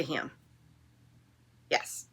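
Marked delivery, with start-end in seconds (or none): none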